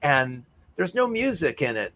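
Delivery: ordinary delivery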